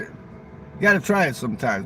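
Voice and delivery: usual speaking voice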